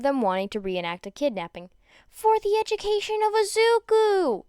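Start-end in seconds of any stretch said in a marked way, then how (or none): 2.1-4.4 high, loud proclamation; excited high rallying cry; gleeful cheer. Acted. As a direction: declare it with mock gusto. Classmate cheers kidnapping idea.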